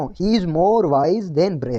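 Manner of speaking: very slow, deliberate reading; slow dictation. Shown as a demonstration. Teacher dictates the correct sentence slowly to students. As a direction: spell out the right form for the class. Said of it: "इसको ऐसे नहीं बोलेंगे".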